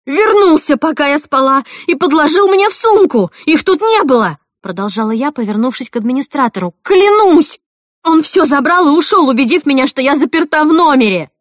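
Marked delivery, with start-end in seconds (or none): 0.0-4.4 loud, very high accusation; raised, agitated outburst; frantic shouting. A narrator's reading. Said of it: "закричала я". 6.8-11.3 very high, loud frantic insistence; desperate shout. Acted. Desperate swearing to administrator.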